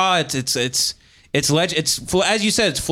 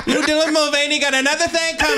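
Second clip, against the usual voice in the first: much higher, laughing campy put-on voice; campy mock voice; 13 semitones higher than usual. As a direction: join in with a hammed-up sassy voice.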